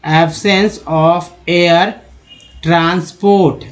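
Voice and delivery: drawn-out, deliberate read of each word; slow dictation-style reading; slow syllable-by-syllable reading. Shown as a demonstration. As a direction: spell it out slowly for learners. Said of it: "तो sense हो गया".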